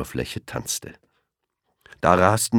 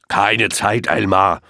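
impatient lion; 4 semitones higher, 5 dB louder, slower than usual. A narrator's reading. Lion cuts Elmar off on the way to his nap, brusque. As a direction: cut him off impatiently. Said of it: "unterbrach ihn der Löwe".

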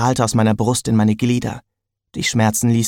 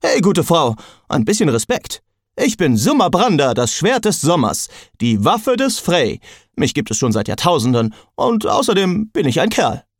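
higher, offended sword voice that demands respect; hammed-up indignation from a talking sword; indignant talking sword; 5 semitones higher than usual. A narrator's reading. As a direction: puff up as a proud, insulted magic sword. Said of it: "fragte Jack empört".

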